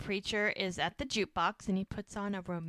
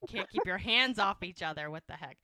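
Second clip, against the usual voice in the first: mock exasperation; 2 semitones higher, 7 dB louder than usual. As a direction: act out an eye-rolling complaint, easing off at the end. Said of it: "he kinda rolls his eyes".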